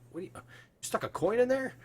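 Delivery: high-pitched incredulous question; guard baffled by the jammed lock. Acted. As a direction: pitch jumps up in disbelief. Guard finds coin jammed in lock.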